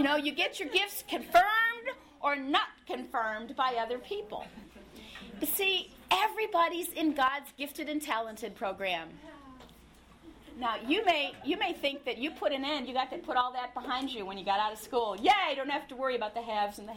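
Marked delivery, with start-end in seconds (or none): none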